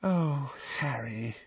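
soft, sighing pity, falling away; quiet, sorrowful reproach; sadly. A narrator's reading. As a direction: let it sink gently in sad sympathy. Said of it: "she said sadly".

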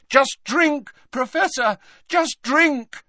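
high, pressing plea; desperate urging. A narrator's reading. Harry making Dumbledore drink, desperately urging. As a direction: urge him insistently, pitched high.

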